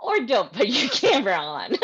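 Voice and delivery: laughing through the words; giggling while speaking. Real, not acted. Laughs at her own remark.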